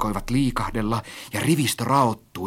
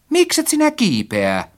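high-pitched impatient prod; urging; 13 semitones higher, 4 dB louder than usual. A narrator's reading. Narrator urges hesitant friend to climb, impatiently. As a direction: hurry them up with the question.

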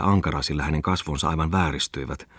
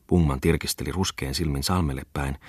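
ordinary delivery

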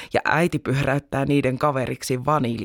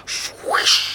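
whooshing splash. A narrator's reading. Whale splash sound effect.

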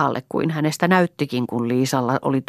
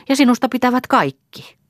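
gushing; 10 semitones higher, 5 dB louder than usual. A narrator's reading. Liisa praising Elsa fondly.

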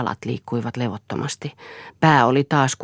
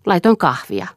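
put-on a girl's higher voice, cheekily defiant; brazen; 6 semitones higher, 4 dB louder than usual. A narrator's reading. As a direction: toss the lie out boldly. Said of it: "sanoi hän julkeasti". (röyhkeästi, uhmakkaasti)

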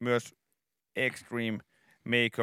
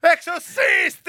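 much higher, loud slow cheering; much higher, louder gushing shout; hyped host shout; 9 dB louder, slower than usual. Retold or imitated. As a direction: sell the reveal at full volume.

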